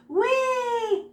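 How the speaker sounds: put-on gleeful kid-style whoop; high playful whee. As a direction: squeal a high "whee" like a ride. Paint flying across.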